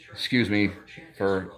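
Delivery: normal talking voice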